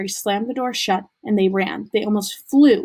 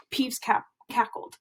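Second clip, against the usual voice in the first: ordinary delivery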